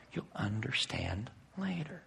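tender whispered promise. Acted. Voicing Jesus' gentle promise.

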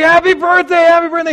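loud, high cheering of happy birthday; crowd cheering. Retold or imitated. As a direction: shout it out like a roomful of partygoers. Crowd erupts at surprise party.

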